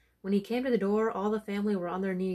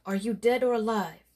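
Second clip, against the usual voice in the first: startled demand; 3 semitones higher, 8 dB louder than usual. Acted. Priest challenges the ghost.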